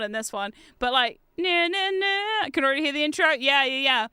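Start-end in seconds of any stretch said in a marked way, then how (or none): none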